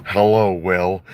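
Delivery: Jesse Ventura greeting, lightly voiced; a hint of a gruff wrestler's hello; Jesse Ventura impression. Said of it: "Will Sasso's impression of Jesse Ventura".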